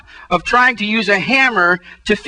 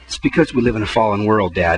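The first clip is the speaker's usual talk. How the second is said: much lower, flat know-it-all son; son's deadpan answer; 14 semitones lower than usual. Retold or imitated. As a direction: say it like the obvious truth. Son's calm theological reply.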